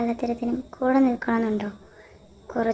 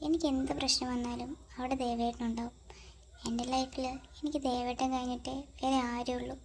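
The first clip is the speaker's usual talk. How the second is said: much softer, hushed voice; 10 dB quieter than usual. Acted. A girl wondering about a late call from Ram, hushed and subdued.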